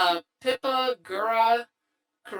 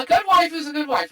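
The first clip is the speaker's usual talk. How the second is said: excited; 7 semitones higher, 9 dB louder, faster than usual. Real, not acted. Defending his taste in waifus.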